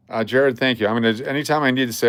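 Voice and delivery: normal talking voice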